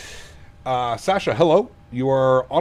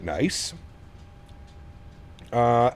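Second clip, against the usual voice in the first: usual speaking voice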